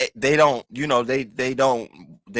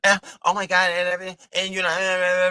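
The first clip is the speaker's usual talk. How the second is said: mock Uppity; 7 semitones higher, faster than usual. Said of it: "Uppity went up there".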